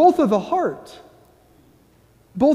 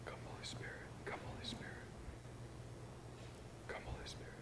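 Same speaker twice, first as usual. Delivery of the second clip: hushed whispered invocation, repeated; whispered prayer; 31 dB quieter, much faster than usual. Real, not acted. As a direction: breathe the words like a prayer. Priest opens guided prayer.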